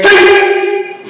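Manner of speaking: sharp, high drill shout; loud shout. Shown as a demonstration. Instructor cueing a downward block, loud shout. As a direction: shout the count high and sudden. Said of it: "and a loud shout".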